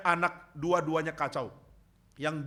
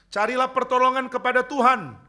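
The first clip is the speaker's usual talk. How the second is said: higher and louder, crying out the appeal; fervent preaching cry; 8 semitones higher, 8 dB louder than usual. Shown as a demonstration. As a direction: call out "pertolongan" with full force. (seruan khotbah yang lantang)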